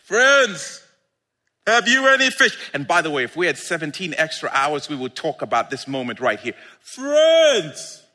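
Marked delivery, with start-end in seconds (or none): 0.0-2.6 raised, higher hail; calling out. Acted. Jesus hailing disciples about fish, calling out. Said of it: "he called out to them". 6.8-8.0 high, drawn-out hail; calling out. Acted. Jesus hailing his disciples from shore, calling out. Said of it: "he called out to them".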